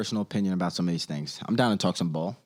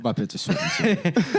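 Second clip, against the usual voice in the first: high laughing echo; 9 semitones higher than usual. Real, not acted. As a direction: laugh through the words. Laughing echo of friend's line.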